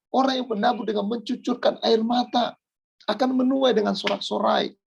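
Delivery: high, ringing exhortation; impassioned preaching. Real, not acted. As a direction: preach it high, urging the congregation on. Preaches with raised voice. (khotbah berapi-api bernada tinggi)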